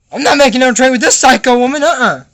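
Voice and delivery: mock-outraged outburst; indignant refusal. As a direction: refuse it loudly, acting outraged. Acted refusal of a trade.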